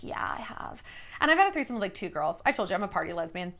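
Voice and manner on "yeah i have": breathy whispered yeah, I have; whispered answer. Retold or imitated. Answering the young boyfriend in a soft whisper. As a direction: whisper it like a secret in bed. Retold intimate night talk.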